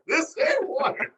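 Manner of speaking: high, slow mock complaint, stretched out; drawn-out high whine. Repeated, drawn out higher.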